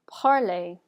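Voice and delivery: "parler" as "par-lay", final R silent; French pronunciation. Shown as a demonstration. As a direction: model "parler" with its ending rhyming with play. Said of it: "listen to the pronunciation for this".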